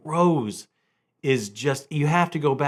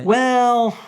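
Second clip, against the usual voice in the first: high, loud caught-out "Well,"; stall, caught red-handed; a startled stall before answering; 9 semitones higher, 6 dB louder than usual. Real, not acted. Caught out by question.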